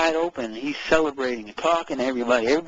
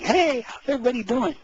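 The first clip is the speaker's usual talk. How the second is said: cheery greeting; 8 semitones higher than usual. Retold or imitated. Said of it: "I don't feel like saying".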